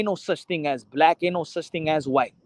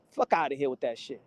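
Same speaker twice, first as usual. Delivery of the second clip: ordinary delivery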